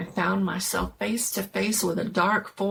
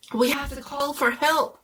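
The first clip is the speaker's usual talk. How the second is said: urgent plea; 7 semitones higher than usual. Acted.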